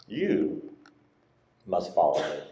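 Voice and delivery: "You" set apart by a pause before "must follow me"; stress on You. Acted. Jesus answering Peter about John, "You" stressed on its own. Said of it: "I emphasize the word".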